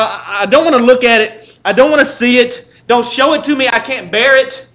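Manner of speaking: loud, high recoiling plea; anguished refusal, slowing at the end; crying out. Acted. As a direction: turn away, unable to bear it. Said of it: "We want to cry".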